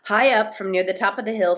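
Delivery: ordinary delivery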